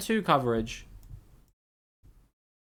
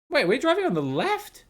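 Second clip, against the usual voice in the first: sudden surprise; 10 semitones higher than usual. Real, not acted. Streamer spots left-side driving, startled high question. Noticing traffic side mid-game.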